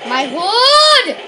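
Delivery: dismayed cry. Real, not acted.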